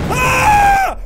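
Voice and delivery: long piercing high scream; real high-pitched shriek of fear; scared scream. Real, not acted. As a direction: scream at full volume in terror. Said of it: "I'm scared".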